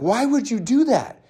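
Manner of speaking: high, drawn-out incredulous question. Acted. Coach challenging people who go it alone, incredulous.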